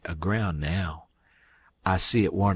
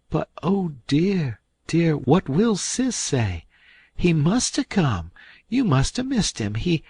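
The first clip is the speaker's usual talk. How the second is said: fretting Aunt Sally; 9 semitones higher, 3 dB louder than usual. A narrator's reading. Aunt Sally frets again.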